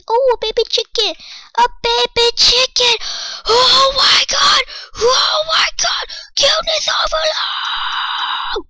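very high, loud squealing; cuteness squeal. Real, not acted. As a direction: shriek with delight at a tiny cute animal. Overcome by cute baby chicken.

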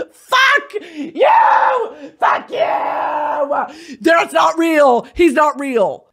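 high, tearful mock-outraged shout; overwhelmed fan yelling. Fan reacting to a singer's lyrics, overwhelmed yelling. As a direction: yell it as if the lyrics hurt.

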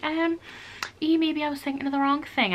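high-pitched, hesitant climb-down; stall, then sheepishly backtrack; awkward backtracking. Acted. Caught bluffing, backtracks awkwardly.

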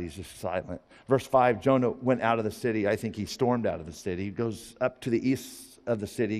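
ordinary talk

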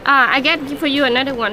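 ordinary talk, nothing marked

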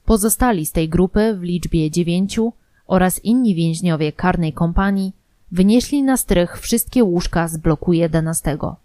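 ordinary delivery

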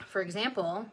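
ordinary talk, nothing marked

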